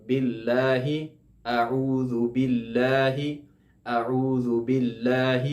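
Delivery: slow اعوذ باللہ with thin lam. Shown as a demonstration. Teacher reciting the full phrase for students to copy. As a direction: recite slowly, light l. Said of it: "اس کو پڑھیں گے باللہ".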